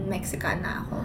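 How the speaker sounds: hushed, unvoiced mock label; whispered quote. Retelling what locals call her, in a whisper. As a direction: whisper it like gossip.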